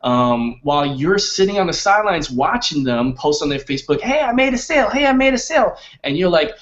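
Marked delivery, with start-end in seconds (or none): 4.0-5.8 high, chirpy mock announcement; much higher, excited mock bragging; imitated excited boast. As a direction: imitate someone crowing over good news. Voicing excited Facebook posts of others.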